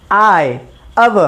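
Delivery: आय and अव drawn out slowly and distinctly; careful sound demo. Shown as a demonstration. Teacher demonstrating the sounds आय, अव slowly. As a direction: lay out आय, अव slowly for the class. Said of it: "इस तरीके का उच्चारण होगा".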